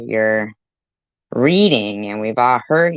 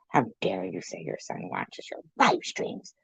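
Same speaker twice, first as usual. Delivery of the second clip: breathy unvoiced hush, far softer; mock whisper; 3 semitones lower, 11 dB quieter than usual. Streamer mockingly scolds Chicken Winner in a hushed whisper. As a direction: hiss the scolding like a fake-shocked gossip.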